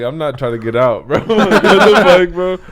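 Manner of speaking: loud, high, laughing what the fuck; laughing disbelief. Real, not acted. Guard recalling the man throwing a garbage can, laughing disbelief.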